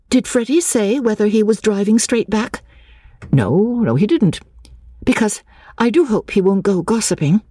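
high, questioning heroine voice; interested young woman. Acted. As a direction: perk up and ask, in a high voice.

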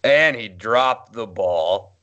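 slow, deflated radio call; disappointed announcer. Retold or imitated. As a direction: imitate an announcer's crushed letdown. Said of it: "the disappointment of David Kellum's voice".

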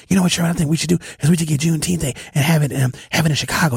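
imitating a caller who talks real fast; rapid-fire caller. Host mimicking a regular caller who rushes every word. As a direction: rattle it off breathlessly fast like the caller. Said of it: "She be talking real fast".

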